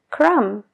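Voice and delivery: "crumb" said as crum, no b; silent b in crumb. Shown as a demonstration. Teacher models "crumb" with the silent b. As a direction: end "crumb" on the m. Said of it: "la B no se pronuncia".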